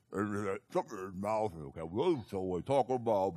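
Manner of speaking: slurred, barely intelligible boxer talk; garbled mumbling boxer. Retold or imitated. Preacher imitating Rocky butchering English, garbled mumble. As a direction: mumble as if something is stuffed in the mouth. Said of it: "you could hardly understand him".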